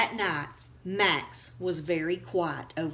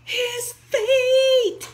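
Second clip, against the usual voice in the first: much higher, loud scared cry; frightened yell; 7 dB louder than usual. Acted. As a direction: shriek in horror. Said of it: "yelled Dakota".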